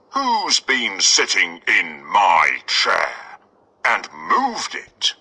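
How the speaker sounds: slow gruff papa bear; Father Bear noticing his chair moved, slow. Acted. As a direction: drag it out like a big bear.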